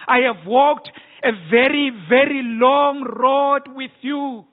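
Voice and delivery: impassioned pulpit voice speaking as God; preaching as God. Acted. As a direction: thunder the Lord's complaint from the pulpit. Preaching God's words at full force.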